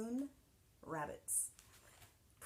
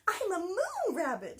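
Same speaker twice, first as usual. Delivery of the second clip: high, loud playful calling out; joyful call; 11 semitones higher, 8 dB louder than usual. Acted. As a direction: shout it while bounding along. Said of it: "she called".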